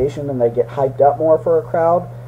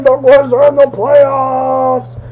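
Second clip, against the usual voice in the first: excited; 9 semitones higher, 4 dB louder, much slower than usual. Real, not acted. Excited about Grizz playoffs.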